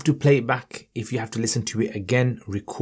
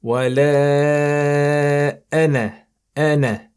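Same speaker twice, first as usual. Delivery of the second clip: slow, melodic tajweed chant, higher; Quran recitation; 4 semitones higher, 4 dB louder, slower than usual. Shown as a demonstration. Teacher reciting for students, melodic tajweed chant.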